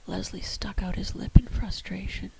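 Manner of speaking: usual speaking voice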